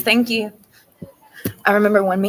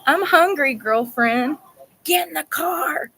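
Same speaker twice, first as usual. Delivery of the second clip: 1 mock skit voice; 7 semitones higher, slower than usual. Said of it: "It's like".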